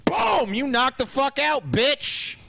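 mock shout. Imagined punch, shouted.

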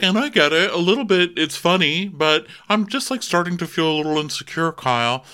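high, put-on confiding voice; mimicked housewife, high-pitched; acted-out friend. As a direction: plead a little in a high voice. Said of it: "And she's like".

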